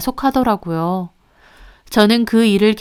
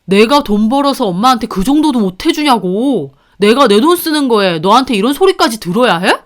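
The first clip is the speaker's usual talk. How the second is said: angry husband; 4 dB louder than usual. Acted. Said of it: "버럭하며 성질을 내더라고요".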